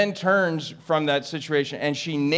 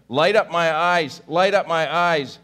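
crying out; 5 semitones higher, 6 dB louder than usual. Acted. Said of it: "He cries out".

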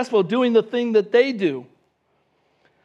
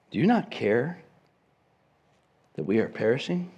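quiet reflective reading; 8 semitones lower, 6 dB quieter than usual. Shown as a demonstration. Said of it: "The emphasis is not on".

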